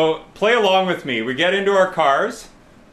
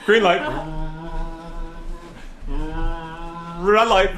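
calling out commands. Pretend. Leader running red light green light, calling out. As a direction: call it out to the room.

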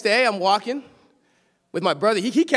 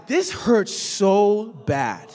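normal talking voice

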